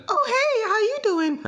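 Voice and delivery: very high falsetto girl impression; put-on girly falsetto, far above his own voice. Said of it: "girl, uh, she, she'll be like".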